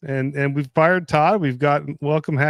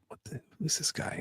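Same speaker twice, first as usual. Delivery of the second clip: breathy whisper, no voice at all; whispered aside; 4 semitones higher, 13 dB quieter than usual. Retold or imitated. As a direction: whisper it like a secret to a friend. Retold inner reaction at restaurant.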